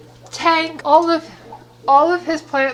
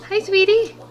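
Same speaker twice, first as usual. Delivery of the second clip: pet talk voice; 6 semitones higher than usual. Real, not acted. Cooing to the fish.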